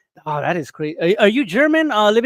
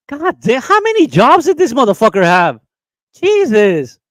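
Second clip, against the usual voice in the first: loud and high, fed-up disbelief; incredulous outburst; 4 semitones higher, 6 dB louder than usual. Real, not acted. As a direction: exclaim it in shocked exasperation. Exasperated over George's many jobs.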